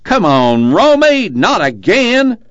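high, loud exasperated yell; high-pitched drawn-out holler. A narrator's reading. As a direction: call out from a truck window. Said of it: "The driver leaned out and yelled".

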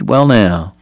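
ordinary delivery

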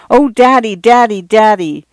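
put-on high, bright cry of joy from a little girl; excited child. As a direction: throw it out like a delighted daughter. Daughters fling arms around father.

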